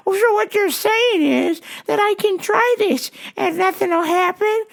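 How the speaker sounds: put-on high-pitched, puzzled old man; puzzled old customer. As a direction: play a clueless customer up high. Voices old customer in story.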